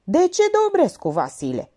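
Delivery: high, loud exasperated snap; captain snapping at the lieutenant for the answer. A narrator's reading. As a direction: bark the question at him. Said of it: "se răsti la locotenent".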